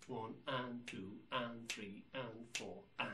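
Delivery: even, steady count; straight eighths. Shown as a demonstration. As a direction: split each beat evenly in two. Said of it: "These are known as straight eighth notes".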